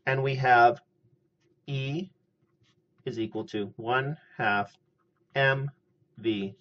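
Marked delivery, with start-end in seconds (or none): none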